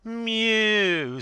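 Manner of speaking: high, loud derisive mew; mocking cat mew. A narrator's reading. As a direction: mew loudly like a jeering cat. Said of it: "says the cat".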